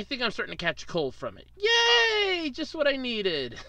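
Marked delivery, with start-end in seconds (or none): 1.6-3.6 high mock-happy yay; sarcastic cheer. Real, not acted. Catching a cold, mock joy.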